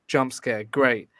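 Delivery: ordinary delivery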